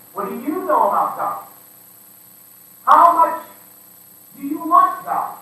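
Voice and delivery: loud, high, challenging; pushy rallying questions. Acted. Preacher acting a believer pushing another, loud. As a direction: fire the questions at them.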